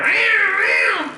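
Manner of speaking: high screeching meows; shrill cat meow imitation; cat yowl. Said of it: "with the cat going".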